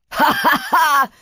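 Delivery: high, gloating ha-ha-ha; villain laugh. Acted. Brachus about to fire on the Neons, villainous laughing. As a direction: laugh in triumph before you strike.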